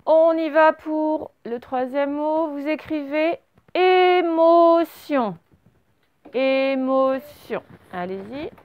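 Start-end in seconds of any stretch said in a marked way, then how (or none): 3.7-5.4 slow, high, long-held syllables. Shown as a demonstration. Teacher dictates third word to pupils, syllable by syllable. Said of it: "fonctionnez bien syllabe par syllabe". 6.3-7.6 very slow, drawn-out syllables. Shown as a demonstration. Teacher repeats third word for writing, syllable by syllable. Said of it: "fonctionnez bien syllabe par syllabe".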